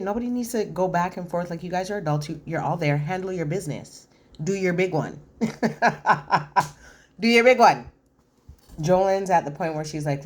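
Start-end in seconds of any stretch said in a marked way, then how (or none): 4.4-7.9 laughing. Real, not acted.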